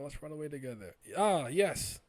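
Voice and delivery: lifted pitch, rising to a loud yes; acted urging. Character urges companion to flee together, acted urging with a loud high reply. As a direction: act out the escape eagerly. Acted escape dialogue, later re-read plainly.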